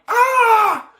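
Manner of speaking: high, loud mock scream; sudden exaggerated yelp of terror; freak-out scream. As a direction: shriek like someone panicking at a monster. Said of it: "he's not freaking out".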